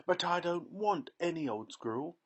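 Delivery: high, loud petulant shout; spoiled girl shouting back at her mother; whiny shout. A narrator's reading. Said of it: "Veruca shouted".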